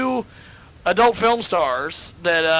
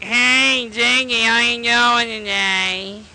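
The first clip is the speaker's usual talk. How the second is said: Fran Drescher voice; 5 semitones higher, slower than usual. Retold or imitated. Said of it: "she even sounds like Fran Drescher".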